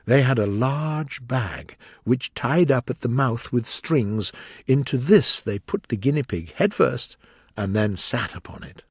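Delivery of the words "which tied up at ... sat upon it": ordinary delivery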